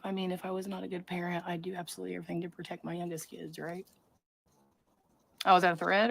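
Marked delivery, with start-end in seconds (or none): 0.0-3.9 quick whispered aside to chat; conspiratorial whisper, a touch snide; sarcastic whisper. Real, not acted. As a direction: hiss it fast and under your breath. Sarcastic aside about bad parent.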